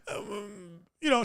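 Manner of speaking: usual speaking voice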